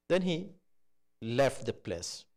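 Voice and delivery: normal talking voice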